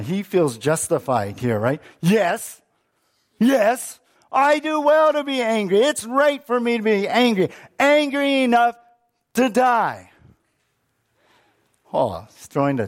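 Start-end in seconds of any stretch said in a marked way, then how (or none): 2.0-10.1 high, loud defiant insistence; Jonah's tantrum. Acted. Preacher as Jonah answering God, defiant tantrum. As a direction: stamp your foot, insist you're right. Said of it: "And here's Jonah".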